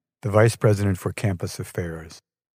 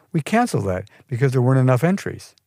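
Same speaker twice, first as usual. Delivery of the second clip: ordinary delivery, nothing marked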